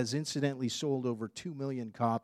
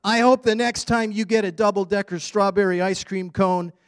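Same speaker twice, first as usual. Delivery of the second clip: put-on lively, louder storybook voice of a grumpy boy; reading to children; 4 semitones higher, 9 dB louder than usual. Preacher reading Alexander's story to congregation, animated. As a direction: read it like a cranky kid telling his bad day.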